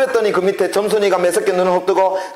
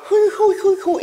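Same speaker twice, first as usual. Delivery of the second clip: whistle blowing; much slower than usual. Shown as a demonstration. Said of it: "호두기만 어떻게 불었더라".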